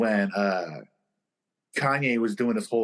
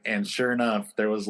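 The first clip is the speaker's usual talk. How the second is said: usual speaking voice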